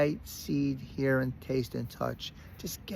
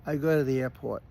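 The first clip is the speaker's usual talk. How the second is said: ordinary delivery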